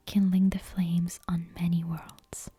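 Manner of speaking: plain reading voice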